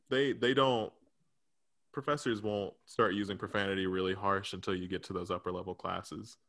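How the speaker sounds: ordinary talk, nothing marked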